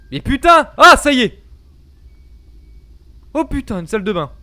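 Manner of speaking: startled scream; sudden frightened yell; panicking gamer. Real, not acted. Jump scare in horror game.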